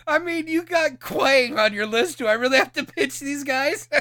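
high and loud, laughing through. Real, not acted. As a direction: plead while cracking up. Still laughing at the list.

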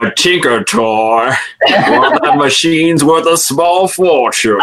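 hammed-up boastful inventor through laughter; laughing cartoon quote. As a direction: quote the cartoon while cracking up. Quoting the show, laughing.

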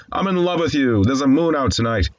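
high-pitched lovestruck quote; euphoric. Retold or imitated. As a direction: lightly act out a giddy declaration of love. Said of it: "caught up in the excitement and the euphoria".

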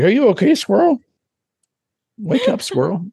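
high mock baby-talk to a squirrel; mock gorilla coo. Gorilla fussing over the squirrel it killed, high coo.

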